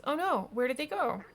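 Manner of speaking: high, loud concerned question; acted alarm. Wake asks about the lost cats, acted alarm. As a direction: exclaim it in mock dismay.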